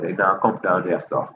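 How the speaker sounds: normal talking voice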